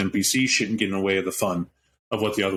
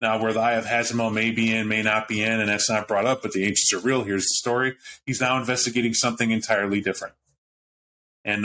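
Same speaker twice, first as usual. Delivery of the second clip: ordinary delivery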